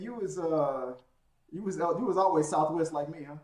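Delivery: ordinary delivery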